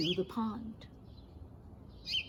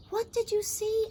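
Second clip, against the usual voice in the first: fish voice; 10 semitones higher than usual. Acted.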